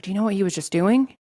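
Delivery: loud, urgent, high question; whisper-shout it in alarm. Retold or imitated. Urgently telling friend aside.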